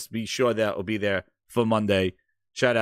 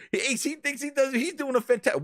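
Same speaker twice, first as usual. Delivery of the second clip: usual speaking voice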